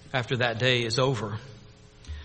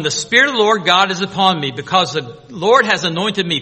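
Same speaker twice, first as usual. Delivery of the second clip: louder, higher preaching voice; scripture proclamation; 3 semitones higher, 6 dB louder than usual. Acted.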